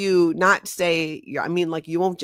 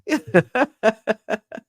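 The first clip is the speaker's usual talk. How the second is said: bright laugh; 7 semitones higher, 5 dB louder than usual. Real, not acted. Reacting to chat comment.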